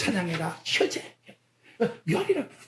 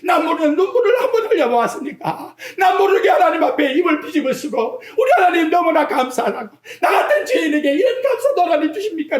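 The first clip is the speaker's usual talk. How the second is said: wailing thankful prayer; 7 semitones higher than usual. Retold or imitated. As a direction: pour out thanks like a weeping sinner. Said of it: "라고 통곡을 한번 해보았습니까".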